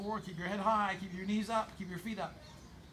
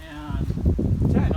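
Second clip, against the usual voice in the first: coach count shout; 7 semitones higher, 9 dB louder than usual. Real, not acted. Coach calling final count.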